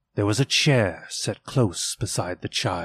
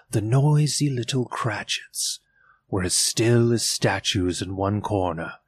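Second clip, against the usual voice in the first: usual speaking voice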